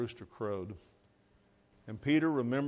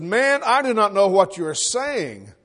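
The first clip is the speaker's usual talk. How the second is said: loud, high, slow protest; emphatic denial; 7 semitones higher, 12 dB louder than usual. Acted. Peter denying the Lord to his accuser, loud and emphatic. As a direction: deny it forcefully, drawing out the words.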